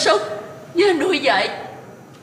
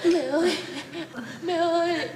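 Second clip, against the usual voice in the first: tearful calling; 3 semitones higher, 7 dB quieter, slower than usual. Acted. Son parting at visit end.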